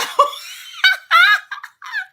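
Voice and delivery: loud high-pitched laugh; high cackle. Real, not acted. Vlogger cackling after the ad line. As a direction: cackle at your own joke. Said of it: "Do you want it now?".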